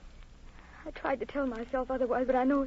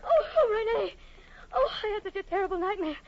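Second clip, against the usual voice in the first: much higher, louder panicked calling; terrified cry; 11 semitones higher, 3 dB louder than usual. Acted. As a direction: wake from a nightmare crying out. Wakes from nightmare calling out.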